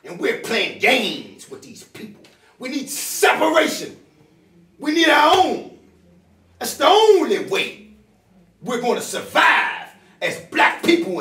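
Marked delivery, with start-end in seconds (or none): 2.6-11.2 raised high, loud declaration; impassioned call. Real, not acted. Preaching to the crowd, rising loud. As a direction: proclaim it to the whole room.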